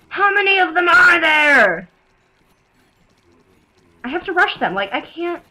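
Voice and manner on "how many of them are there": raised, loud cry of disbelief; alarmed shout. Real, not acted. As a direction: yell out in alarm at the horde. Alarmed by enemies in game.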